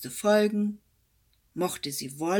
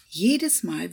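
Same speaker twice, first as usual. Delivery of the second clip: ordinary delivery, nothing marked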